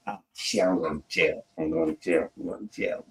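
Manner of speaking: muttered, sinking into an unvoiced whisper; fading dread. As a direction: say it to yourself until only breath is left. Dread repeated, fading to nothing.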